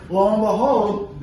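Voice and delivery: slow and loud, each word stretched; dramatic build. Shown as a demonstration. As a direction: land it like a big reveal. Dramatic reveal of the chase.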